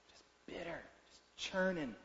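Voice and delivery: whispered, barely voiced; whispered bitter. Real, not acted. Preacher naming the congregation's bitterness, in a whisper. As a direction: breathe the word out like a secret hurt.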